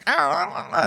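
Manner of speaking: high, soft panicked wailing; small high-pitched mock meltdown; mock freak-out. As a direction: imitate flustered customers going "ah, ah". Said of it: "this whole kind of freak out".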